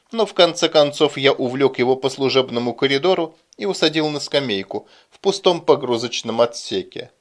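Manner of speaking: usual speaking voice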